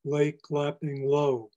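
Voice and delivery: slow deliberate reading to show the Ls; slow stressed L sounds. Shown as a demonstration. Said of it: "Look at all those N, L sounds".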